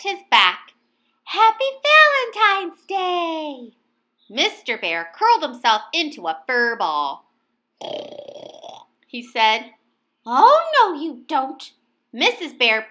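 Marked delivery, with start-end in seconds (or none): 1.2-3.7 high, loud, slow sing-song wish; bright slow holiday cheer; cheerful greeting. A narrator's reading. Poking him awake cheerfully. 10.3-11.7 high loud no; playful scolding wife; playful insisting. A narrator's reading.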